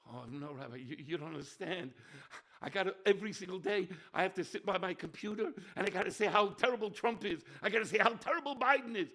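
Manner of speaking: fast, loud mock protest of a busy man; whiny congregant. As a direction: play the harried congregant making excuses. Rabbi voices a complaining congregant.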